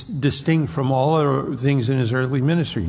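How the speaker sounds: ordinary delivery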